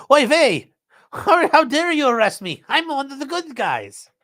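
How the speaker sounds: loud, high put-on Yiddish accent. Acting out the vandal's quote.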